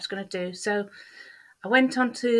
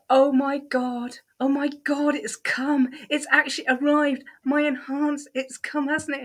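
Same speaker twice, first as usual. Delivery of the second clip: higher, breathless oh my God, slowing on the first; excited disbelief; 6 semitones higher, 4 dB louder than usual. Retold or imitated. Answering the agency's call about the certificate, excitedly higher. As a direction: squeal it like the letter finally came. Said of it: "And I said".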